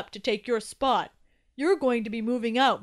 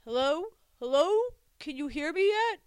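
raised voice calling through a wall; muffled calling; 7 semitones higher, 7 dB louder than usual. A narrator's reading. Hidden voice calling to Toby from below, muffled calling. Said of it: "say in a muffled tone".

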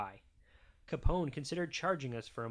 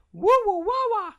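put-on very high, loud baby babble; babbling baby; 14 dB louder than usual. A narrator's reading. Baby Maggie copying her family, high loud babbling. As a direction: squeal out nonsense syllables like a baby. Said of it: "And Maggie said".

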